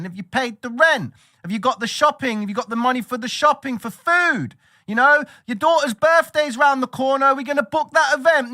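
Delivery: high, loud nagging mother voice; shrill-free but high mock nag. Mother nagging her son about rent and shopping, high and loud.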